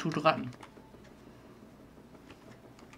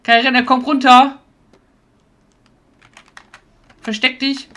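loud, high urgent orders; urgent command; 6 semitones higher, 10 dB louder than usual. Real, not acted. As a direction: hurry them into hiding. Urging teammate to hide.

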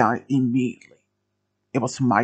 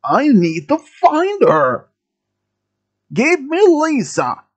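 high, drawn-out acted yell; screaming demand; 9 semitones higher, 4 dB louder, slower than usual. A narrator's reading. Gunman hunting for Lisa among hiding students, screaming demand. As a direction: yell slowly, stretching every demand. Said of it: "kept screaming".

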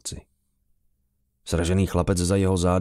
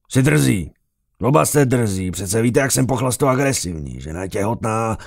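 put-on loud, raised-pitch drunken indignation; angry rebuke; 4 semitones higher, 5 dB louder than usual. A narrator's reading. Officer scolding insolent drinking companions, loud angry rebuke. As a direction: tell them off loudly.

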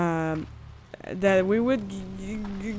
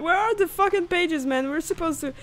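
normal talking voice

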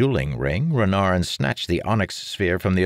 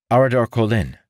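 put-on young man voice; 7 semitones higher, 6 dB louder, faster than usual. Character voice, Marin.

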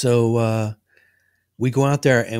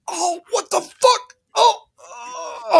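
very high, strained mock cry; retold pained yelp; much slower than usual. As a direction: squeal as if struck in the back. Man yelping after being hit.

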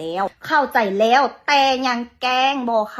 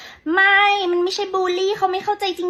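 imitating critics; 8 semitones higher than usual. Commenters arguing it wasn't bullying, mock higher voice. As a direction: parody the online critics defending the clerk. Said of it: "จะต้องมีคนคิดว่า".

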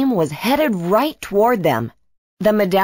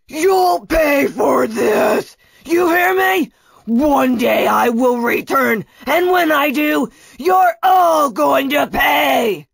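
high, drawn-out villain threats; vengeful shout; 6 semitones higher, 3 dB louder than usual. A narrator's reading. Kunatos shouts back at his traitor gang, furious threats. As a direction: shout your revenge across the water. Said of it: "Kunatos shouted back".

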